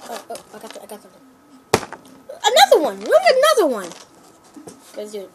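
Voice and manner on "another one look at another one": excited shout. Real, not acted. Finds another chip in bag.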